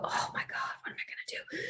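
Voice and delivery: mock-panicked whisper. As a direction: whisper it in fake despair over a paper. Professor mocking her own panic.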